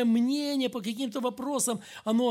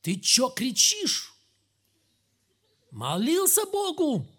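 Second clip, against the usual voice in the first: loud, high, drawn-out indignant comeback; bold retort; 5 semitones higher, 5 dB louder, slower than usual. Retold or imitated. Praying soldier answers the shouting officer, bold retort. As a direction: throw it back loudly, pitch leaping up. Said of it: "ему говорит".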